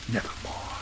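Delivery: soft unvoiced croak of a raven; hushed raven answer; raven's whisper. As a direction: breathe the word like a dark bird.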